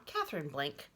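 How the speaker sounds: ordinary delivery, nothing marked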